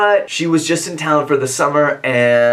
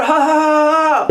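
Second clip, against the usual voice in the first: mock jeering laugh; 11 semitones higher than usual. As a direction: cackle like a smug onlooker. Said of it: "people would be like".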